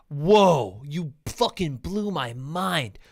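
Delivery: mock amazement, dripping sarcasm; sarcastic mind-blown voice; sarcastic awe. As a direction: fake being blown away. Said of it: "And it's like".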